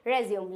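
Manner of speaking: "रिज्यूम" as re-zyoom; wrong pronunciation. Shown as a demonstration. Teacher shows a mistake, "रिज्यूम" as re-zyoom. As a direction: say "रिज्यूम" with no final vowel. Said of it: "ये होता है गलत".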